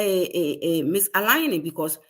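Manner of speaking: ordinary delivery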